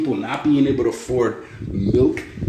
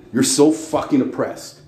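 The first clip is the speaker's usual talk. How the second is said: ordinary delivery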